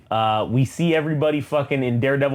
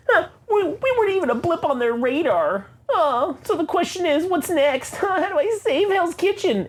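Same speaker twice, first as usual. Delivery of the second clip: much higher, whimpering, near tears; whiny crying hero. Acted. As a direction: whine it like a wounded ego. Daredevil whining about being ignored.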